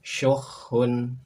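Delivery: "Syukhun" clear, raspy kh; model Arabic reading. Shown as a demonstration. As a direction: sound out "Syukhun". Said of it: "Berikutnya silakan". ("Syukhun" dengan kha jelas)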